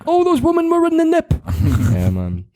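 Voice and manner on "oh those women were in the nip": character quote. Retold or imitated. Quoting a show line.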